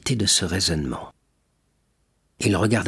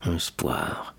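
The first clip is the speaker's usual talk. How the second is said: «Espoir» murmured much softer, lower, barely out; choked murmur; 4 semitones lower, 7 dB quieter than usual. A narrator's reading. Fernand tries to offer hope but chokes, soft murmur. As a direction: force «Espoir» out, the word dying in the throat. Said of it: "essaya de murmurer Fernand".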